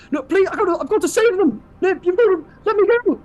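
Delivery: very high, loud desperate yelling; frantic pleading. Acted. Warrior held by the arms, kicking, frantic pleading. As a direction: plead wildly while struggling against a hold. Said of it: "he's, like, kicking".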